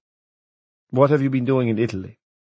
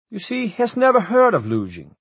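high, louder, gloating bureaucrat; triumphant official; 12 semitones higher, 3 dB louder than usual. A narrator's reading. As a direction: score the point over your rival.

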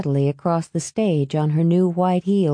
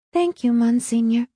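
put-on higher-pitched polite girl; young graduate's thanks; 6 semitones higher than usual. A narrator's reading. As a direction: lightly voice a girl thanking a priest. Girl accepting her diploma onstage.

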